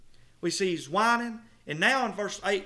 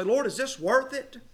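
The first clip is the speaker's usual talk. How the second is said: higher, slow anguished question to the Lord; despairing plea; 7 semitones higher than usual. Retold or imitated. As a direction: plead in doubt. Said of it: "You'll say".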